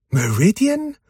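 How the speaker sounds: ask, baffled to see her; confused question. A narrator's reading. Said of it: "fragte Searcher verwirrt". (verwirrt, höher fragend)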